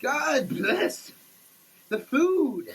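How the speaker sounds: ordinary talk, nothing marked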